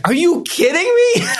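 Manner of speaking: half-laughing at his own tears; laughing disbelief. Retold or imitated. Son laughing at his mom crying over the bad concert. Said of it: "I was like".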